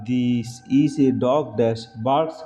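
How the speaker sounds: very slow question reading; slow dictation. Shown as a demonstration. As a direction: read the question slowly for the class. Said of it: "reads question slowly". (ধীরে প্রশ্ন পড়া)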